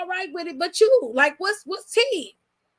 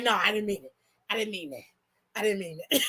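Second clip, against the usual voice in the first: much lower and much softer, timid backing down; imitating a meek apology; 8 semitones lower, 6 dB quieter, faster than usual. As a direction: shrink into a small, scared apology with a laugh. Jey backing down meekly.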